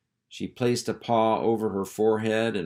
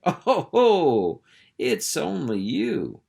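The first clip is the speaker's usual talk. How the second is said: laughing it off, higher and slow; amused laugh; 8 semitones higher, 5 dB louder, slower than usual. Acted. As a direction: chuckle through the words. Maggie laughing at Henry's odor.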